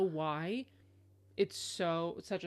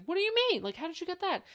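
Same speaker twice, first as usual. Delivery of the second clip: much higher and louder, incredulous; baffled; 11 semitones higher, 5 dB louder than usual. Retold or imitated. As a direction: act out two people who can't believe it. Acting out two baffled people.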